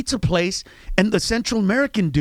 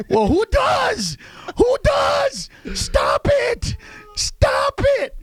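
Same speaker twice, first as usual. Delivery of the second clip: mock shout; much slower than usual. Real, not acted. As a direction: shout it way up at them. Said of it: "mocking shout".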